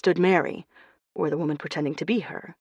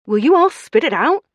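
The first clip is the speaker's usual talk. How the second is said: anxious, high and loud; 9 semitones higher, 8 dB louder than usual. A narrator's reading. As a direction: burst out impatiently. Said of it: "anxiously looking between them".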